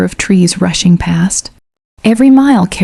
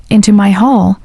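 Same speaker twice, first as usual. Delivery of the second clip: ordinary delivery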